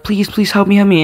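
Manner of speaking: beg, lightly acted; pleading for help. Crying boy begging the neighbor to help, retold plea. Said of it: "I'm like".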